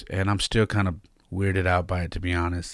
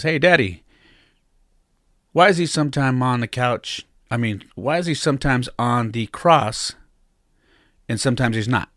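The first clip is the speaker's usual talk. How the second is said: put-on a higher, louder child's voice, tapering off; imitating his young son; 5 semitones higher, 4 dB louder than usual. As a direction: play the curious little boy in the back seat, pitch up. Young son asking from back seat.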